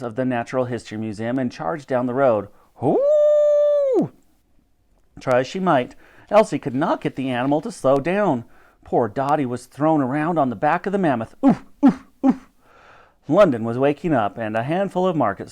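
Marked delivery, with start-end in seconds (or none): 2.8-4.1 very high, sustained hoot; mammoth's trumpeting. A narrator's reading. Narrator voices the charging mammoth. 11.4-12.5 short, high "oof" noises; bumped grunts. A narrator's reading. Dottie bounced about on the mammoth.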